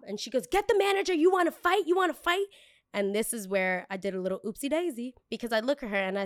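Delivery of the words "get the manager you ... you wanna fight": high, loud screeching challenge; yelling entitled customer. Retold or imitated. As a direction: yell it like a furious customer.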